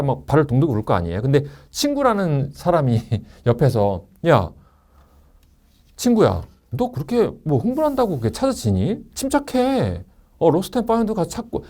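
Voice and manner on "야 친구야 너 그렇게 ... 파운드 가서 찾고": high-pitched mock voice of reason; logical friend. Friend advising the one who lost a wallet, pitched-up mock reasoning. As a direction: lecture a panicking friend to calm down. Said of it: "논리적으로 보이긴 하지만".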